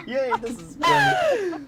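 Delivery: thrilled squeal; delighted. Real, not acted. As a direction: squeal with glee. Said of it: "delighted GM".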